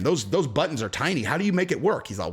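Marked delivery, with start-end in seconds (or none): none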